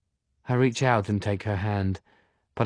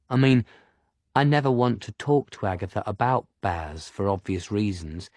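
ordinary delivery

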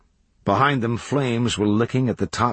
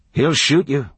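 high, raised warning; called warning; 7 semitones higher, 4 dB louder than usual. A narrator's reading. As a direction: call a warning up the stairs. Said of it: "leaned and called to them".

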